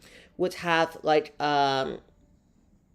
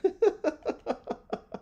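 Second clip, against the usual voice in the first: high, helpless giggle; embarrassed laugh; 11 semitones higher, 4 dB quieter than usual. Real, not acted. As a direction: giggle at your own slip. Laughs at own accidental innuendo.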